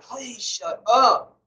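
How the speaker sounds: drawn-out, weary shut up to two bickering people; mock tired plea to quit arguing; exasperated plea. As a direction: beg them to stop, fed up. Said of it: "I'm like".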